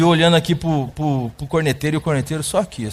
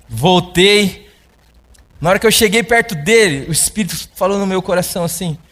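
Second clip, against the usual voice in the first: usual speaking voice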